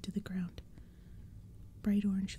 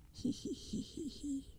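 evil dry cackle; 7 semitones higher, 7 dB quieter, slower than usual. Acted. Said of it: "They laughed their ugly laughs again".

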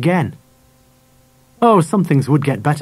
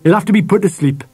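higher, decisive pronouncement; great finality; 6 semitones higher than usual. A narrator's reading. Father decides the dog's fate, with great finality. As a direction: lay down the verdict. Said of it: "said Dad with great finality".

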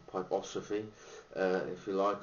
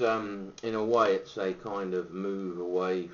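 ordinary talk, nothing marked

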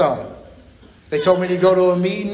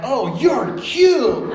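flirty homeless lady; 8 semitones higher, 3 dB louder, much slower than usual. Retold or imitated. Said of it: "hops out of line and is like".

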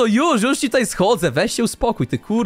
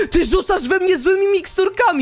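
high indignant shout; outraged yelp; indignant accusation; 7 semitones higher than usual. Real, not acted. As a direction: accuse your teammate at a higher pitch. Accuses teammate of throwing potions.

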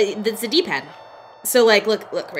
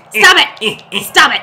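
loud, sharp exclamation; mock scolding; 5 dB louder, slower than usual. Real, not acted. Player tells off the laughing friend, mock scolding. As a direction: call out a mock telling-off.

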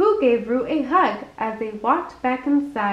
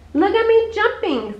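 put-on higher, louder squeak of a small child; tiny voice squeaking with glee; little squeaky voice; 8 semitones higher, 3 dB louder than usual. A narrator's reading. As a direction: squeal it out mid-bounce. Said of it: "squeaked a little voice".

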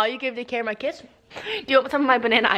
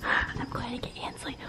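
whispering; 3 semitones higher, 12 dB quieter, slower than usual. Real, not acted. Sneaking out at night.

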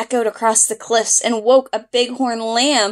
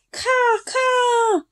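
high, scratchy caw caw; crow caw; 13 semitones higher than usual. Acted. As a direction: call out like a crow. Bird call.

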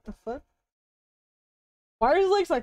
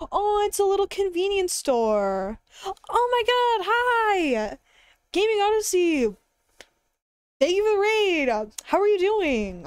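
high bright excited greeting; cute anime streamer; 7 semitones higher than usual. Real, not acted. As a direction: squeal a greeting to the raiders.

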